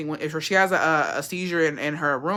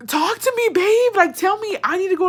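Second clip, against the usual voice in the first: high frantic pleading; frantic plea; 13 semitones higher, 4 dB louder than usual. Retold or imitated. Woman pleading with the silent aunt, high and frantic. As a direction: beg her to speak, pitched high. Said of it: "I was like".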